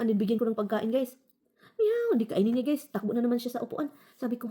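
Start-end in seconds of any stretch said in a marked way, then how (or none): 1.7-2.1 high, loud plaintive meow; cat meow. Retold or imitated. Imitating the cat crying on the chair, meowing. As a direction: cry like the scared cat.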